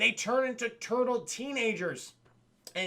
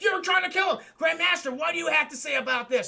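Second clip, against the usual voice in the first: higher, louder angry yell; guard's accusing shout; 8 semitones higher, 5 dB louder than usual. Retold or imitated. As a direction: bellow the accusation at him. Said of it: "He's like".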